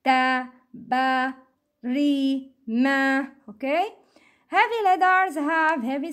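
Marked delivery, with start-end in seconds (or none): 0.0-3.3 "Ta, ba, ri, ma" one by one with thin vowels; light sounds. Shown as a demonstration. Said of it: "to make a light sound". ("Ta, ba, ri, ma" as separate light syllables)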